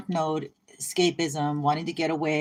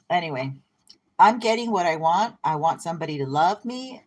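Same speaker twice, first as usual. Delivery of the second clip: ordinary talk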